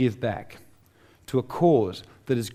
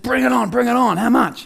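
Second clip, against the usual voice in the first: high, loud, eager acceptance of the challenge; eager excitement; 9 semitones higher, 4 dB louder than usual. Retold or imitated. Accepting David's price for mentoring, eager and excited. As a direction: jump at the offer, thrilled.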